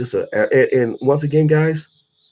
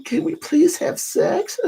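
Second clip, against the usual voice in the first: high, slow, whiny begging; a wimpy boyfriend pleading, pitched high and slow; mock pleading man; 13 semitones higher than usual. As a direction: beg like a timid man, way up high and drawn out. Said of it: "you're gonna be like".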